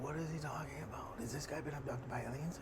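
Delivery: quiet, whispery question; quiet hushed retold wonder; hushed disbelief. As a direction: mutter it under your breath in awe.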